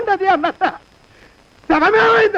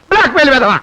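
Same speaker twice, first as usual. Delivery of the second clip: louder curse; 2 semitones lower, 5 dB louder than usual. Acted.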